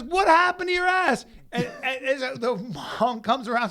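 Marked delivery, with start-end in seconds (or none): 0.0-1.3 loud high mocking yell; mock shout. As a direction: ham up the outraged yell. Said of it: "He goes, he goes".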